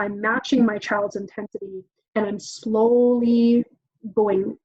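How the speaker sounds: ordinary delivery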